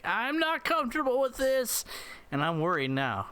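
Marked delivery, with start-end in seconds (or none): 0.0-1.9 high-pitched comic whine of protest; put-on high, uneasy voice; mock protesting pilot. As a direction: squeal it high as the spooked enemy. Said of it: "and he's just like".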